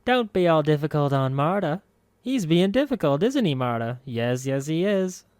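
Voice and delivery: high sing-song crone talking to herself; sing-song mumble. A narrator's reading. Old woman mumbling to herself over a corpse, high sing-song. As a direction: croon it to yourself like a batty old woman. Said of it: "mumbled to herself in a sing-song voice".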